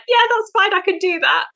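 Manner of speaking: high, airy self-imitation; breezy confidence. Agility handler now unfazed by a full course, breezy confidence. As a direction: toss it off as if nothing to it.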